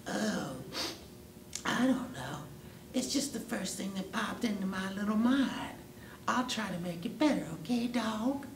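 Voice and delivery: high, small innocent cat voice. Acted. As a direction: plead ignorance sweetly in a high voice. Cat excuses itself to dog.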